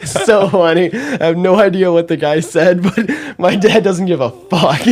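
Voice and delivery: high, loud words broken by laughter; laughing hard. Real, not acted. Telling a friend about shameless dad, laughing hard. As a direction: talk right through a laughing fit. Cracking up at own story.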